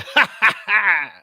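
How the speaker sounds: short hearty laugh; brief chuckle. Real, not acted. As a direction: laugh at your own joke. Laughs at own joke.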